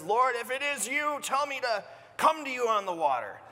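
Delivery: lightly voiced eager Peter; voicing Peter. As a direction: call out across the waves. Peter calling across the water.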